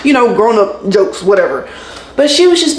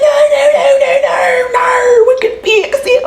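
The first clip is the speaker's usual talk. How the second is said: very high squealing imitation; hammed-up, drawn-out frantic protest; mock panicked pleading; slower than usual. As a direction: squeal it like a desperate agent. Mimicking agent panicking at cancellation threat.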